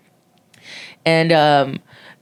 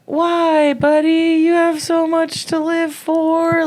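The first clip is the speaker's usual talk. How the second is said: pleading; 11 semitones higher, slower than usual. Retold or imitated. Retold talking him out of it.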